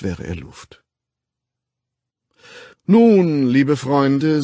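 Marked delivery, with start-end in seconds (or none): 2.9-4.4 booming ceremonial address; resonant, carrying voice. A narrator's reading. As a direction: project like a master of ceremonies. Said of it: "mit derselben wunderbar tragenden Stimme".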